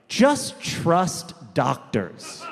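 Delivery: very slow, drawn-out mock advice; slow, deliberate imitation of earnest advice; quoting people's advice. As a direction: stretch each word as a quoted slogan. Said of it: "when you hear people be like".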